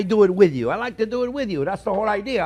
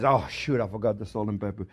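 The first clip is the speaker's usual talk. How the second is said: self-quote; 11 semitones lower, 6 dB quieter than usual. Retold or imitated. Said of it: "and then I realize".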